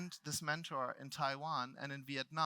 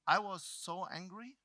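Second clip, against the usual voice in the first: usual speaking voice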